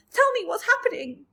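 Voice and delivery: high, loud begging cry; panicked plea. A narrator's reading. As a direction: beg frantically to know. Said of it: "wailed Earthworm".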